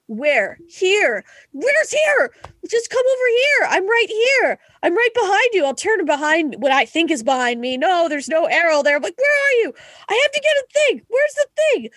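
very high frantic yelling; panicked yelling. Retold or imitated. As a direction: shout at a teammate you can't find. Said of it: "I will yell with all my might".

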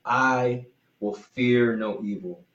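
slow, weighty reading of the verse; slow scripture quote. Retold or imitated. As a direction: let each word of "I will fear no evil" land slowly. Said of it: "David says".

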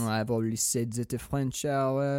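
put-on French accent, softly spoken; musing French film buff; English with a soft French accent. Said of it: "I base my French accent off of".

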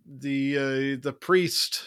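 ordinary talk, nothing marked

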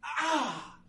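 high yelp of a stubbed toe; a sudden high cry of pain. Acted. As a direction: yelp on hitting metal in the dark. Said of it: "you hear him go".

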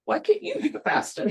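ordinary talk, nothing marked

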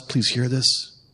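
soft, intimate appeal; hushed plea. Real, not acted. Preacher begging the congregation to hear, hushed. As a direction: drop almost to a murmur and plead.

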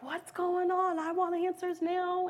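demanding answers, pitched high; a passenger yelling at officers; retold yelling. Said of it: "one guy was yelling at them".

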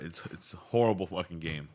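ordinary talk, nothing marked

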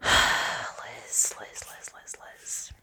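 breathy sighing whisper of her name; soft, despairing whisper; pleading whisper to the author; whispered exasperation. Real, not acted.

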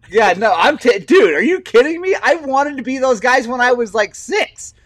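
real excited outburst about the compliment. Real, not acted. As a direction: gush like a delighted fan. Excited about Muppet comparison.

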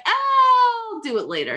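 high, slow whiny put-off; mock whiny teen stalling; lazy brush-off.

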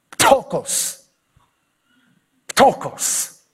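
"Ptochos" with a punchy, spitting Pt at the start; "Ptochos" burst out like spitting at someone; spat-out Greek word. Shown as a demonstration. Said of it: "one of those that imitates the sound".

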